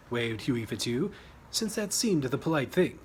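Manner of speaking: usual speaking voice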